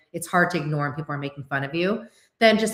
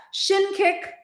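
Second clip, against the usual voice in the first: playful callout; 13 semitones higher than usual. Acted. Naming a drama move playfully.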